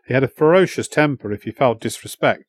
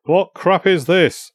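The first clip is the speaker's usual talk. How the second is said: slow, higher-pitched outraged demand; 6 semitones higher, slower than usual. A narrator's reading. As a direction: drag the words out in fury. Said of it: "face was now almost purple".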